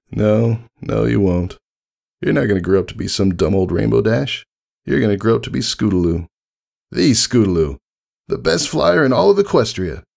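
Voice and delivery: high, lively pony voice, teasing then proud; Rainbow Dash voice. Acted. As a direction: cheer the kid on, quick and warm.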